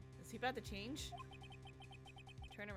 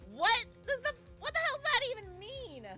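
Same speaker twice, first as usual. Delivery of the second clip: high, loud disbelieving squawk; shocked outburst; 9 dB louder than usual. Real, not acted. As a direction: blurt it out in high-pitched shock.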